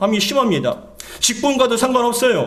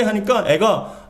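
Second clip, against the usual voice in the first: ordinary delivery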